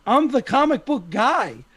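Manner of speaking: high anxious imitation of the director; worried Kevin Smith. Kevin Smith fretting over an offer, high and anxious. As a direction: fret in a high put-on voice. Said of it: "he's probably like".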